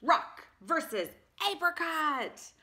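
loud, high fight announcer; big arena announcement. Acted. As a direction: call out the matchup like a ring announcer. Announcing the matchup.

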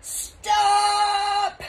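high, sustained loud yell. A narrator's reading. Taya yells at the man lifting her, very loud. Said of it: "Taya yelled very loudly".

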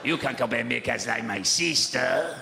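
mock Mexican accent ad voice. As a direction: sell it slowly in a hammed-up Mexican accent. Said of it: "Or they were Mexican".

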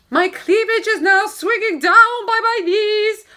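high, loud exhausted rant; hammed-up frazzled mum; knackered mother crying out. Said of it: "The knackered young mother cried out".

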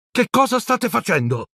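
put-on high-pitched startled boy's question; suspicious boy. A narrator's reading. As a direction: pipe up high, catching friends out. Neville surprises friends from a corner. (ragazzino sospettoso, voce acuta)

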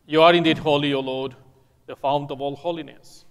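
normal talking voice

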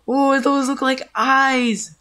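spooked, stretched-out realisation; alarmed realisation. Real, not acted. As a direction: stretch out the Oh as it sinks in. Repeats it, more alarmed.

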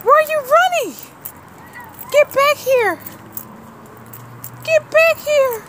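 calling out. Real, not acted. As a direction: yell for her to come back. Calling after a runaway.

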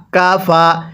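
usual speaking voice

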